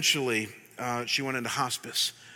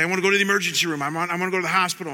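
fast, louder, frantic retold plea; panicked plea; 3 semitones higher, 6 dB louder, much faster than usual. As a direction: rush it like a panicked patient. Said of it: "she would just freak out".

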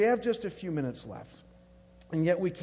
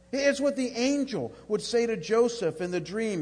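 high, loud, fervent preaching; full-force preaching; 5 semitones higher, 7 dB louder than usual. Acted. Pastor urging the congregation about Jesus, at full preaching force. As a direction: build the sermon to a passionate peak.

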